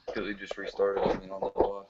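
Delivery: quiet voice through a phone; distant phone-line sound; over the phone. Real, not acted. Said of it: "so I'm on my phone right now".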